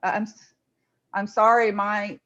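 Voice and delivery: ordinary delivery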